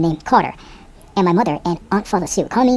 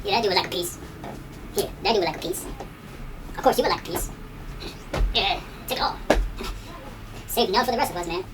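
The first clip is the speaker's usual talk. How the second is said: sarcastic muttering; 12 dB quieter, faster than usual. Retold or imitated. Sarcastic friend in the back.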